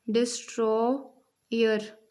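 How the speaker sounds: "Destroyer" slowly broken into डिस्, ट्रॉ, यर; slow syllable-by-syllable "destroyer"; split into syllables. Shown as a demonstration. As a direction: give "destroyer" three separate beats. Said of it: "इसमें three sounds आ रही हैं".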